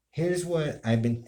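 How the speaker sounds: ordinary delivery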